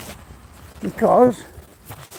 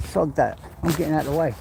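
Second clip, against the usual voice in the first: ordinary talk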